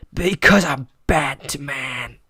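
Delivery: slow gravelly-free whispered Batman catchphrase; slow unvoiced Batman impression. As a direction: breathe it out like the Dark Knight.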